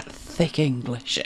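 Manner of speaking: low, soft, slow scornful aside; Spaniards' verdict. Retold or imitated. Host voices Spaniards judging tourists, low and slow. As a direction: mutter it with quiet scorn. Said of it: "the Spaniards must look at them and think".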